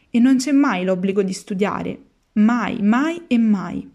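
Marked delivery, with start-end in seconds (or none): 2.3-3.9 slow emphatic. Acted. Lucignolo insists no school.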